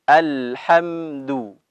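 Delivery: slow Alhamdu with rounded lips on dal; Quranic recitation. Shown as a demonstration. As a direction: stretch out Alhamdu, lips pushed forward. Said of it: "Abi ulangi". (bacaan pelan dengan bibir dimonyongkan)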